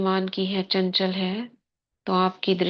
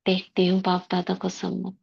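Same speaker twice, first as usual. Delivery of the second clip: guided meditation. Acted. Sister guiding the class into soul-consciousness before murli, meditative.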